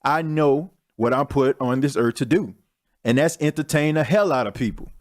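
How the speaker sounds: ordinary talk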